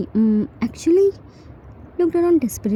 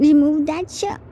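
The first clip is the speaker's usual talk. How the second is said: tipsy demand; 5 semitones higher than usual. Acted. Drunk Jimin demands.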